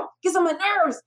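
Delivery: high-pitched groan of annoyance; mock fed-up grumble; retold exasperation. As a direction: let the irritation burst out, replaying it. Acts out her irritation at mentor.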